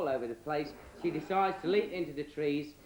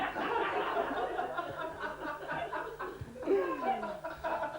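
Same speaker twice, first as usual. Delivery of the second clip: long high-pitched laugh at his own punchline; comic breaking up at his own pun; 11 semitones higher than usual. Real, not acted. Teller laughs at own joke.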